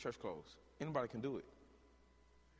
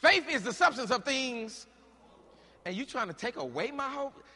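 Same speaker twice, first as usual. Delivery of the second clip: rising preaching burst; 8 semitones higher, 8 dB louder than usual. Acted.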